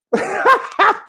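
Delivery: high-pitched burst of laughing; real laughter. Real, not acted. Streamer amused by his own Brummie impression, high laugh. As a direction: crack up at your own attempt.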